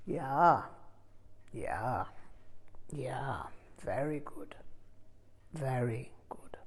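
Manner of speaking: murmured approval dropping to a whisper; fading to a whisper. A narrator's reading. Teacher quietly praising his student, fading to a whisper. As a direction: let the praise sink into a breathy whisper.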